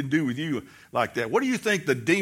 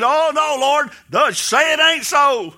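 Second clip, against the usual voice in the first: much higher and louder, slow pleading protest; pleading Peter; 7 semitones higher, 5 dB louder than usual. Acted. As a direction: beg him to take it back.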